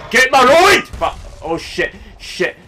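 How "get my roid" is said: sudden high, loud yell; real mid-fight shout; urgent yell. Real, not acted. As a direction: burst out urgently at a teammate. Downed player yelling mid-fight.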